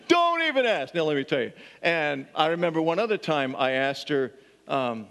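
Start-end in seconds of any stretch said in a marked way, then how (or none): 0.1-0.9 a cheerfully grumpy sigh of a line, pitched way up; grumpy cashier. Retold or imitated. Cashier answering the pastor's question in a high, mock-weary voice. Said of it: "she could then tell me".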